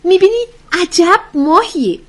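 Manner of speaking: high, loud mock-wonder character voice; playful teasing. Joker teasing her smiling friends, playful and high-pitched. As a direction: tease in a high, loud pitch, stretching the exclamation.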